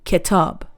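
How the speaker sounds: ki-TAB, weight on the last syllable; "kitab" stressed at the end. Shown as a demonstration. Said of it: "stress is on tab".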